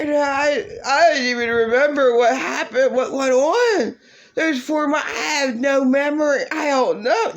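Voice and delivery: high, whimpering mock voice; mocking whine. Mocking the ex's weepy excuse, high and whiny. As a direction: whine it like a fake sob story. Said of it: "worst bullshit fucking apology ever".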